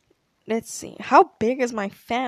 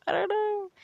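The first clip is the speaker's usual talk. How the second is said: innocent high "I don't know"; 12 semitones higher than usual. Retold or imitated. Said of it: "And I was like".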